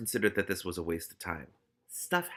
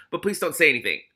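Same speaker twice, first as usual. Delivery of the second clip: hurried; 5 semitones higher, 7 dB louder than usual. A narrator's reading. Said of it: "added hurriedly".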